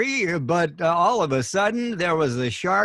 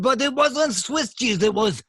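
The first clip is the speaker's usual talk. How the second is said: hammed-up French accent, higher; comic French accent, higher pitched and shouted at the end; 6 semitones higher than usual. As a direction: play a jokey Frenchman, rising to a shout. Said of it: "I think you went back into the French".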